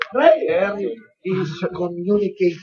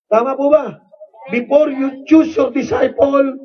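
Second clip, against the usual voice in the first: usual speaking voice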